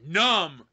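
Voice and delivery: "numb" stretched out and loud; stress on NUMB. Real, not acted. Host reading a comment's capital letters, "numb" drawn out loud. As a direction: hit "numb" hard, long and loud. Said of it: "Numb was in capital letters".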